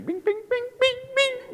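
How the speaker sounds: very high, pinging imitation of a taut string; ping like a string about to snap; banjo string twang. Husband describing his tense wife, banjo-string pinging. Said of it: "like a banjo string".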